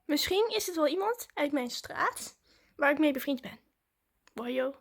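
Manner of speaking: ordinary talk, nothing marked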